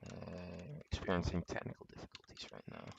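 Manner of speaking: fast, soft whispered aside. Real, not acted. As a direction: mutter it quickly under breath. Stream glitch aside.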